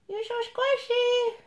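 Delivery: very high, squishy-mouthed coo; baby talk. Pretend.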